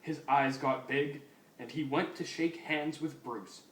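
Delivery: ordinary delivery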